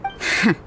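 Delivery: breathy, dismissive little laugh under the breath. Acted. Scoffs at her trusting view.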